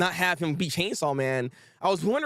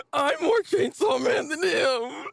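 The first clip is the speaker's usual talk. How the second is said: high, drawn-out hammed-up wail; crying; 10 semitones higher, slower than usual. Manga character breaking down, hammed-up high crying shout. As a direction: wail it slowly in mock tears. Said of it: "why are you crying".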